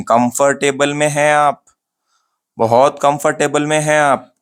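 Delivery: "कंफर्टेबल" with every syllable full, ending in "table"; wrong pronunciation. Shown as a demonstration. Teacher mimics students' mistake, full "table" in "कंफर्टेबल". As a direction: say kum-far-TAY-bul for "कंफर्टेबल". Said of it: "गलत pronunciation है".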